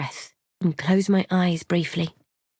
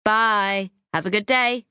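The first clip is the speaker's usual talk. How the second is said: raised-pitch goodbye called from another room; lightly projected, higher-pitched reply; called back; 4 semitones higher, slower than usual. A narrator's reading. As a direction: boyfriend calling a farewell back. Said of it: "he calls back".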